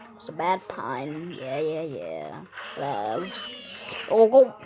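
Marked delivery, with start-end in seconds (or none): none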